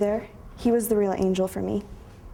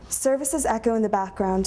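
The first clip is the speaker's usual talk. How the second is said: ordinary delivery, nothing marked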